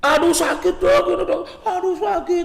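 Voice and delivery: high-pitched whiny cry of pain; high, overdramatic child's protest; mock child's yelling. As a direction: act a kid wailing that it hurts. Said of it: "dia akan teriak-teriak".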